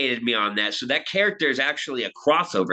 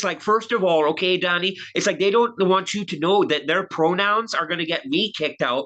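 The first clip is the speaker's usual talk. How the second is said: higher-pitched mimicry of a Canadian pundit; Jordan Peterson; 3 semitones higher than usual. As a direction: imitate a complaining psychiatrist, pitched up. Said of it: "He talks like this".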